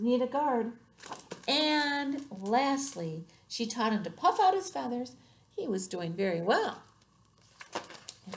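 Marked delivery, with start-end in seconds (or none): none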